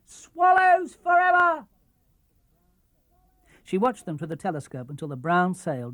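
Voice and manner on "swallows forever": high, loud, slow cheer; cheering the ship away; joyful shout. Acted. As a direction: shout it after the boat. Titty cheers departing boat.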